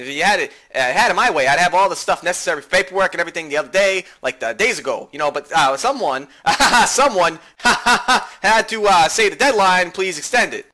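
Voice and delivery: fast, pushy chatter with laughs; excitable classmate. Acted. [name] needling someone about today's deadline, excitable and laughing.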